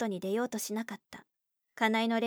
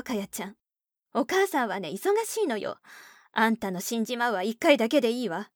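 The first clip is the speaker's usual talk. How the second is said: louder, scolding mother voice; irritated mother; 4 dB louder than usual. Acted. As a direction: snap at her impatiently. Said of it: "腹立たしそうに言った".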